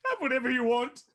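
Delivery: high, loud acted cry; overwrought outburst. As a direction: blurt it out loudly as you leave. Tearful barmaid throws out the cask.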